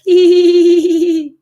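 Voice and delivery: high, loud, long rippling "heee"; hee-hee laughter sound. Shown as a demonstration. Coach demonstrating the next sound, hee-hee laughter. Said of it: "try the hee hee sound".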